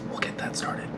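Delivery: soft breathy whisper, not to wake anyone; hushed morning whisper. Real, not acted. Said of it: "I'm whispering".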